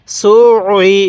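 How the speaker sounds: "সু ই" with a held throat ayn between the vowels; teacher's demonstration of doubled ayn in "সু ই". Shown as a demonstration. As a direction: squeeze the Arabic ayn twice, joining "সু" to "ই". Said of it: "আইনটা কিন্তু আমি দুইবার উচ্চারণ করছি".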